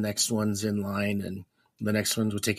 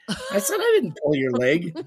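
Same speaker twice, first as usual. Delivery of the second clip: laughing; 8 semitones higher, 4 dB louder than usual. Real, not acted. Both laughing at healed leg.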